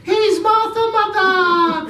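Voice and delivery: put-on small kids cheering; excited children. Young children shout.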